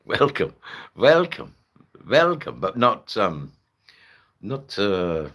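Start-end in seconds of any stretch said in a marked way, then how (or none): 0.0-2.6 high, loud Dutch-style welcome; attempted Dutch accent. Shown as a demonstration. Streamer practising a greeting, higher attempted Dutch accent. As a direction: try out a Dutch greeting, pitched up.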